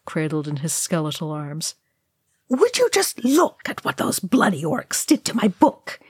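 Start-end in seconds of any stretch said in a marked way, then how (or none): 2.5-6.0 high-pitched, outraged character voice; indignant. A narrator's reading. Ori finding his book ruined by orcs, indignant. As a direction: squawk the complaint in high indignation. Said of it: "he said indignantly".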